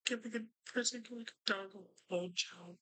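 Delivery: high-pitched parody of the quote; mocking disbelief. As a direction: echo it in a high, pained mock voice. Repeats absurd sentence just read.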